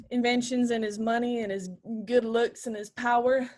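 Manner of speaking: ordinary delivery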